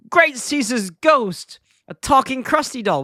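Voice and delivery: high-pitched, drawn-out mock astonishment; Bart. Bart spots the talking doll, mock astonished. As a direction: exclaim it like a startled cartoon boy. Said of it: "Bart starts".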